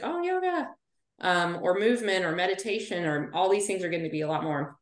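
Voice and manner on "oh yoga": imitating people suddenly gushing about yoga, pitched much higher; swoony, breathless "Oh, yoga" with a big upward swoop; mock gushing. Mocking everyone who now raves about yoga, in a gushing voice pitched much higher. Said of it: "everybody's like".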